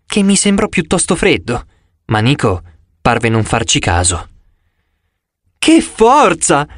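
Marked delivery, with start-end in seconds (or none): 5.6-6.7 put-on high, slow burst of boyish delight; light up with wonder; a kid thrilled by the car; excited exclamation. A narrator's reading. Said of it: "esclamò".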